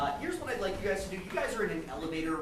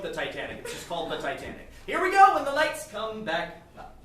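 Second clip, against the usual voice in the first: usual speaking voice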